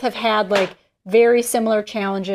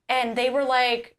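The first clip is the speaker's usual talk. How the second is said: ordinary talk, nothing marked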